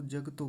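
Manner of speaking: 'जगतो' as jag-to; two quick syllables for 'जगतो'; half ग. Shown as a demonstration. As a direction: drop the vowel after ग in 'जगतो'. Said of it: "ग आधा होता तो".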